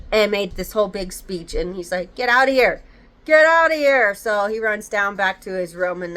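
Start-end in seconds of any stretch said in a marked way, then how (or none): 2.1-4.1 raised, higher voice; mildly urgent call, louder on the repeat; urgent retold order. Policeman telling the boy to get away, urgent order.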